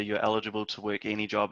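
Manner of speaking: ordinary talk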